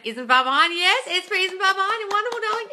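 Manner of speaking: high, loud delighted gushing; excited gush. Real, not acted. Vlogger gushing to a fan, high and loud. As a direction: squeal your delight back at the viewer.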